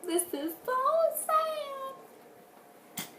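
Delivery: very high, very slow whiny mom voice; mock crying mom. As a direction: drag it out high like a weepy mom. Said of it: "she like tells me".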